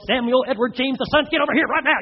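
hammed-up furious parent summons; parent losing it. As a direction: call the child by full name, exasperated. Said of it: "you're starting to lose it".

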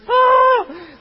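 high loud gasping "ah"; a sudden yelp of dismay; shocked cry. Real, not acted. Preacher reacting to the blunder, high loud yelp.

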